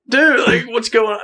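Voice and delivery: high-pitched mock-alarmed protest; retold yelling. Hunter backing away from the landowner, mildly mimicked yelling. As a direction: imitate a yell without full volume. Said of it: "and I'm yelling".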